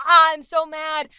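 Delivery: mock yell. Shown as a demonstration. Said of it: "We didn't go yelly".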